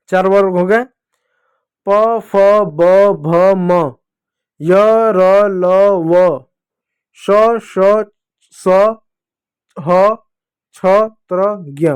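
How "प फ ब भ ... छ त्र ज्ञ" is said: each letter separate, spaced out evenly; deliberate letter-by-letter chant for the class; recite-along drill. Shown as a demonstration. Teacher has pupils repeat each letter group, recite-along drill. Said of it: "बोलिएगा मेरे साथ".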